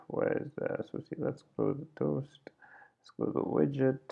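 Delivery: muttering to himself while he looks for the file; a near-silent aside to himself; muttered whisper. Real, not acted.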